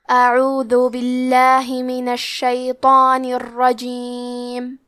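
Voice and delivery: slow, drawn-out tajweed recitation; Quran recitation. Shown as a demonstration. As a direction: recite slowly with measured elongation. Teacher recites Quran verses. (آہستہ تجوید کے ساتھ تلاوت)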